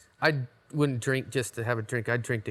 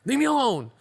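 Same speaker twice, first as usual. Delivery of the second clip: mock whiny outburst; 11 semitones higher, 5 dB louder than usual. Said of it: "I'm like".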